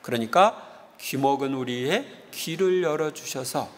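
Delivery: ordinary talk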